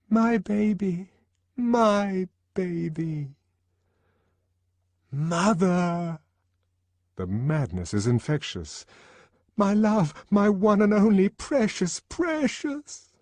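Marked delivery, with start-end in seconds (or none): 0.1-3.4 put-on high, slow, wailing "my baby"; cling to the child in a lament; doting mother. Mad primitive family love. 5.1-6.2 high called-out "Mother!"; the child's cry for mother. Acted. Child crying out. 9.6-13.1 high, louder gushing endearments; doting lover. Acted. Lover's mad devotion.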